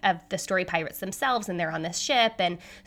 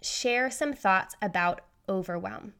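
ordinary talk, nothing marked

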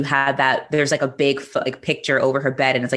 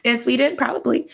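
amused; 10 semitones higher than usual. Retold or imitated. Joking aside, high voice.

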